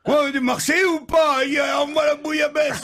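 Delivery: captain shouting to bring the bouillabaisse; proud shout. Acted. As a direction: boom out the order.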